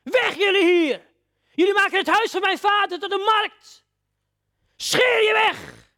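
slow, high, loud furious commands; Jesus roaring at the traders above all the noise; furious shout. Acted. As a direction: roar above the tumult, drawing each word out. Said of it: "de stem die roept".

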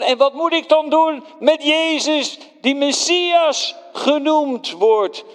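high, loud, pleading question; Pilate pleads loudly. Acted. Pilate pleads with the crowd, high and loud. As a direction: appeal to the crowd one last time. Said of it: "toen zei ik nog van".

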